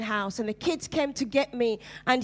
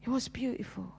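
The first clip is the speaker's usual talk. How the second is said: soft whisper; 5 dB quieter than usual. Real, not acted. Moved testimony, soft close.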